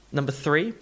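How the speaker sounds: usual speaking voice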